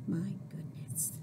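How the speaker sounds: hushed wonder; amazed whisper. Acted. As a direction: breathe it in awe.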